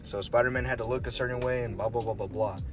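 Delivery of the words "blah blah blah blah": normal talking voice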